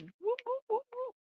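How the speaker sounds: high squeaky whoops; playful whooping. Pretend. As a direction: whoop like a cartoon. Hook whooping the yarn.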